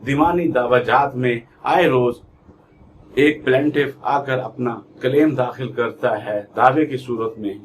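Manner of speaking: normal talking voice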